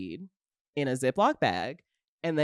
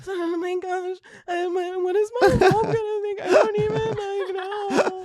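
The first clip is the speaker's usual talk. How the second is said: much higher, fake-tearful girlish pleading; pretending to cry while begging the cop. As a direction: whimper and plead like an innocent girl. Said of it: "begging with this cop".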